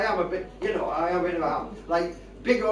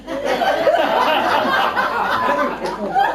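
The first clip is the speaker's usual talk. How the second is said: laughing; 6 semitones higher, 7 dB louder than usual. Real, not acted. Laughing through the story.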